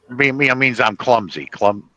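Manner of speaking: usual speaking voice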